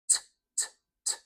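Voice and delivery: "T" as a voiceless released tick; "T" as the bare consonant, not the letter name; true T sound. Shown as a demonstration. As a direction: tap out isolated "T" sounds without a vowel. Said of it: "like a true T".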